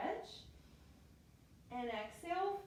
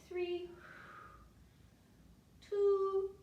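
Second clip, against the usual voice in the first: rising count; 7 semitones higher, 6 dB louder than usual. Real, not acted. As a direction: count down, the last number shouted out loud. Counting down a hard hold.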